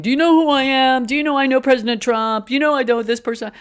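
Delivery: high, loud, indignant name-dropper; entitled caller. Retold or imitated. Job applicant name-dropping Trump on the phone, pompous indignant voice. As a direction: play a pompous applicant demanding respect. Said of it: "can you imagine they're saying".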